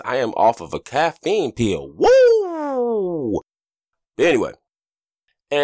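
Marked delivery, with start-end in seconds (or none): 2.0-3.4 long high-pitched "woo"; excited whoop. Real, not acted. Caffeine pill kicking in, excited whoop. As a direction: let out a wired, drawn-out whoop.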